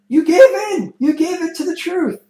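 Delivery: very high, loud gloating shout; triumphant cry. Retold or imitated. Sister watching his true nature revealed, high loud triumphant cry. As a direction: exult that he finally broke. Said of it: "the sister's like".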